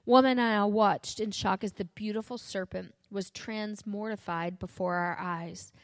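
usual speaking voice

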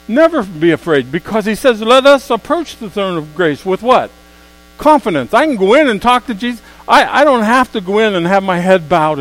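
loud, high preacher's climb; preaching at full force. Acted. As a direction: build the sermon to a shout.

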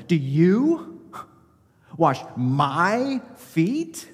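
"you" and "my" hit hard, drawn out very slowly and rising; emphatic pronouns. Retold or imitated. Preacher stressing "you" and "my" in Peter's question to Jesus. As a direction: lean on "you" and "my", stretching "wash my feet?". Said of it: "the pronouns are very emphatic".

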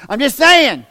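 high, loud outburst; loud comic protest. Real, not acted. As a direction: blurt it out at full volume to the crowd. Bursts out at the congregation.

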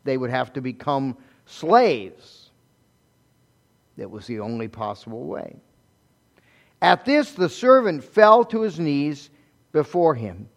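ordinary delivery

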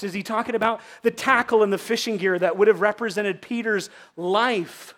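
ordinary talk, nothing marked

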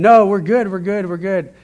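high, loud imitation of insisting; retold brush-off. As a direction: quickly wave off concern, imitating someone else. Women brush off questions, retold.

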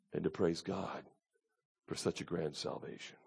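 soft, low voice with long pauses; humbled hush. Real, not acted. Preacher telling beloved congregation it is humbling, hushed. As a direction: drop to a low, reverent near-murmur.

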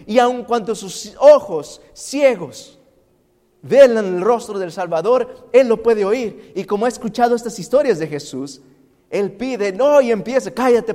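loud, high, impassioned sermon voice; full-force preacher climax; fiery preaching. Acted. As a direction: preach it with rising fire.

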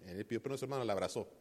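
ordinary talk

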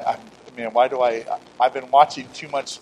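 ordinary talk

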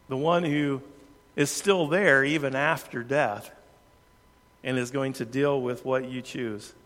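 ordinary talk

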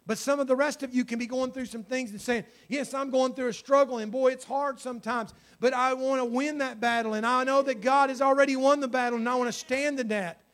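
loud, high, urgent preaching; impassioned altar call. Real, not acted. Preacher appealing to those in spiritual battle, urgently. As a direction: press the appeal home at full voice.

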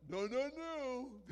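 high, loud, slow finger-wag refusal; mock the shot-blocker's no; imitating a basketball player rejecting a shot; basketball player impression. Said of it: "who's that guy, that basketball player".

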